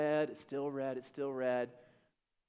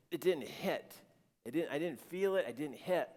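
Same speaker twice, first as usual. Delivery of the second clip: ordinary talk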